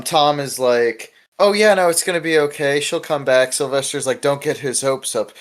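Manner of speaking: normal talking voice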